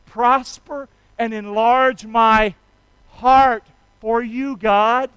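loud, slow, fervent prayer; praying aloud for the church to repeat; prayer lead. Shown as a demonstration. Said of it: "Can you say that with me".

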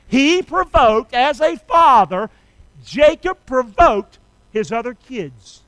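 emphatic, measured preacher's proclamation; forceful preaching. Real, not acted. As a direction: drive the point home phrase by phrase.

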